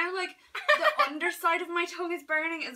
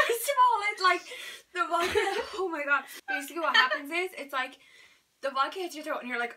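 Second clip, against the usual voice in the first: ordinary talk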